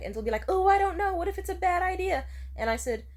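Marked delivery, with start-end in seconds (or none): 0.5-2.2 loud, high-pitched imitation of a worrier; mock panicking. Date balking at walking to the beach, mock panicky. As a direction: fret loudly as an indecisive date. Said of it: "Stop panicking".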